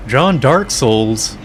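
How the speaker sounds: loud, slow, drawn-out excited announcement. Real, not acted. Excitedly spotting the character.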